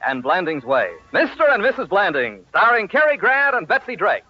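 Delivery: ordinary talk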